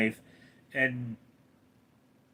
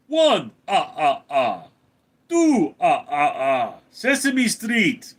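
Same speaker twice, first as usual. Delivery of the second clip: Transylvanian count; 2 semitones higher, 4 dB louder than usual. Acted. Streamer performing a requested count, Transylvanian vampire voice. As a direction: count out loud like a cartoon vampire. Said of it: "Please do Russian count".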